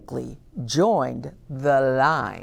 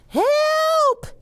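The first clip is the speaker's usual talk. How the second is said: panicked cab driver; slower than usual. Acted. Cab driver yells about the loose bear. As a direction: shriek the warning in a panic. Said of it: "said a cab driver".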